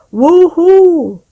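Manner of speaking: high, loud woohoo on a bumpy ride; joyful shout. A narrator's reading. Pete on the bumpy wagon ride, whooping loudly. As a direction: whoop it out with glee. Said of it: "Pete shouts".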